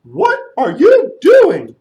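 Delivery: slow, loud, drawn-out trumpeting call; big elephant call. Acted. Elephant stomping up and calling the storyteller. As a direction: boom it out like an elephant.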